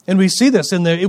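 usual speaking voice

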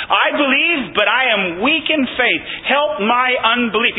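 high, pleading prayer, slowing at the end; fervent prayer. Retold or imitated. Preacher re-voices the father's prayer, high and fervent. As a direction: cry it out to God.

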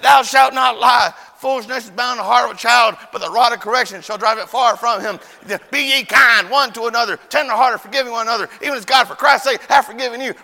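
loud, high, rhythmic scolding recitation; mock maternal Bible tirade; scripture-quoting mama. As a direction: hammer out each verse while whipping the child. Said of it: "my mama can quote scripture, love Jesus, and beat the devil at the same time".

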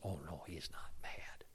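low, near-whispered retort; hushed reassurance. Retold or imitated. Lady reassuring her friend about the preacher, hushed imitation. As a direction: drop to an imitated confiding whisper. Acted friend's confiding reply.

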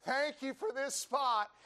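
high, slow mock prayer; grateful praying preacher. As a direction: give drawn-out thanks to God, hammed up. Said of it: "He said".

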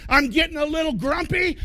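ordinary talk, nothing marked

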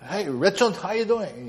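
light mock-British greeting; attempted British accent. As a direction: imitate a British friend, accent not quite there. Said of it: "I can't do his accent".

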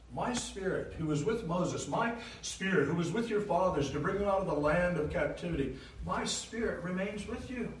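MY spirit pitched high and pressed each time; emphatic repetition. Acted. Preacher stressing God's promise to the remnant, high and emphatic. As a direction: hammer home my spirit.

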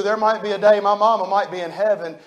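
usual speaking voice

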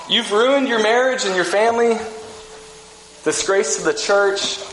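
sarcastic finger-wagging scolding; mock accusing rebuke. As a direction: needle him like a self-righteous critic. Church condemning Jim, mock rebuke.

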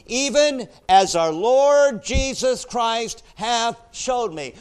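loud, high, driving preacher's voice; full-force preaching. Real, not acted. Preacher rousing the church over the verse, loud and high.

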